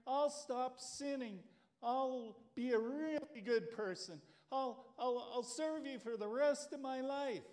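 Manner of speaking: stranded man begs God to save him, desperate; pleading Jack. Acted. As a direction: bargain for your life.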